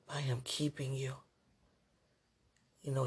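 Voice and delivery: ordinary delivery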